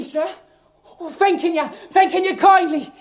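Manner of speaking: ordinary talk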